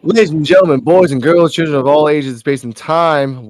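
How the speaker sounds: booming ring announcer intro; grand entrance announcement. Acted. Podcast opening, ring announcer style.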